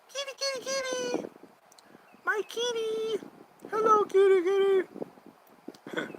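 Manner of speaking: very high squeaky kitty call; high cat-calling voice. Real, not acted.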